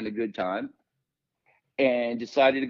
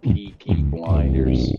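announcer voice; 10 semitones lower, 7 dB louder, much slower than usual. Acted. Show title called out over music.

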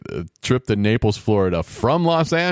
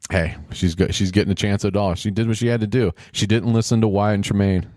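ordinary talk, nothing marked

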